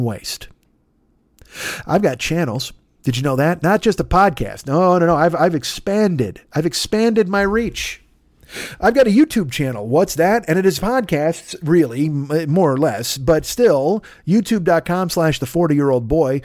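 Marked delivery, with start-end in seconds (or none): none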